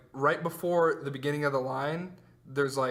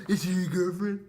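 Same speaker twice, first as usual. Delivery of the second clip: lightly voiced prying mom, rising at the end; a hint of a nosy mother asking; imitating mom; 3 semitones higher than usual. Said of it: "my mom does that".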